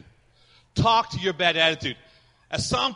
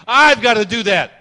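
1 shout; 5 semitones higher, 13 dB louder than usual. Real, not acted. Preacher exhorting congregation.

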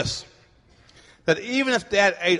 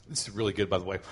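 quiet aside; 12 semitones lower, 11 dB quieter, faster than usual. Real, not acted. Aside while eating Skittles.

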